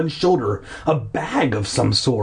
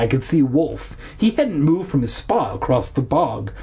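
plain reading voice

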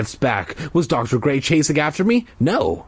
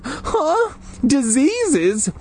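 high squeaky alarm; panicked kid squeak; much higher, startled boy voice; 13 semitones higher, much slower than usual. Acted. Dana alarmed.

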